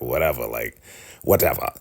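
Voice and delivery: low, curt mock brush-off; pissed-off dismissal. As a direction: imitate someone sulkily dismissing a greeting. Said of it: "She is so pissed off and mad".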